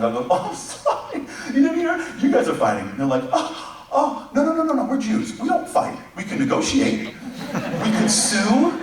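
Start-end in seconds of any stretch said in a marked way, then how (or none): 0.3-2.9 mock sweet God. 3.3-8.8 very high, squealing comic protest; high panicky protest. Retold or imitated.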